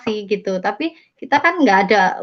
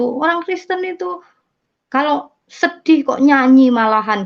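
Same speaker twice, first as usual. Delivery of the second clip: disapproving relatives; 5 semitones higher, 4 dB louder than usual. Retold or imitated. Family mocking Christians singing.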